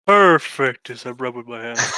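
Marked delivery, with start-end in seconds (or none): 0.0-0.9 excited. Acted. Player in-character delight.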